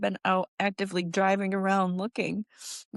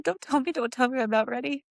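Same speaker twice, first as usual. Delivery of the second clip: high, strained plea of a mother who can't hear the news; panicked pleading; 6 semitones higher than usual. Retold or imitated. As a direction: beg them not to say it, voice pitched up with dread. Retelling hearing of son's death.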